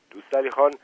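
ordinary delivery

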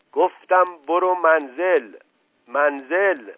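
slow, loud, high-pitched enraged order; furious roar. A narrator's reading. Uncle roaring at disobedient girl, furious. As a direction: roar the command.

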